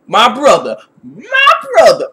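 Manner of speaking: loud, drawn-out, high hammy wail; mock villain. Unalaq jumps in on his brother, hammy loud high wail. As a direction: ham up an outraged, wailing brother act. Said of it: "he's like".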